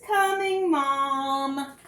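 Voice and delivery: put-on high, bright child's call to Mom; boy answering. Boy answering his mom's call, high child's voice. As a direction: answer like an obedient little boy. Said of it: "And he says".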